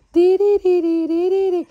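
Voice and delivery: high, loud rolled "tiririri" trill; playful trill. Acted. Mother playing with baby, loud high playful trill. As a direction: trill like a toy to make her laugh.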